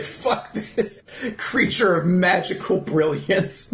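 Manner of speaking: slow, mock-menacing read; spiteful Charmy. Cohost voicing Charmy threatening to eat the fairy, slow. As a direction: drag it out, petty and hungry. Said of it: "She's like".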